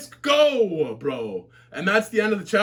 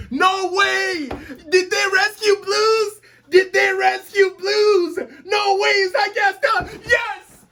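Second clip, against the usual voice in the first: ecstatic shouting; 8 semitones higher, slower than usual. Real, not acted. Reader sees Blues rescued, shouting with tearful joy. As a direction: scream with disbelieving joy. Favorite character rescued, live reaction.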